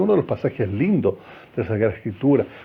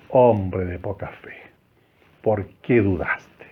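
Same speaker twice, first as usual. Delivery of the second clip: gentle rebuke; 5 semitones lower, slower than usual. Acted. Jesus rebuking Pedro in story.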